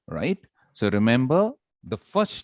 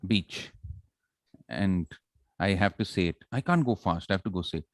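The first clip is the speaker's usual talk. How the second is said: normal talking voice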